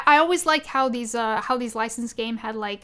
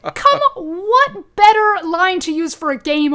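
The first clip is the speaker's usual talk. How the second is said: delighted; 9 semitones higher, 10 dB louder, slower than usual. Real, not acted. Delighted about favorite line.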